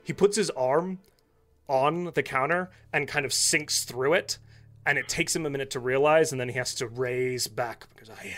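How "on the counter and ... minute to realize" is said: ordinary talk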